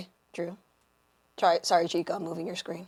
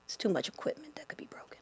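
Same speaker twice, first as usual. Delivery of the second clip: whispering; 2 semitones higher, 12 dB quieter, faster than usual. Real, not acted. Quick whispered aside.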